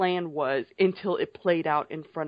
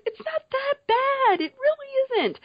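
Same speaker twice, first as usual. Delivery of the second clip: Tyrion voice; 3 dB louder than usual. Retold or imitated. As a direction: play a high, pleading Tyrion. Retelling Tyrion in the episode.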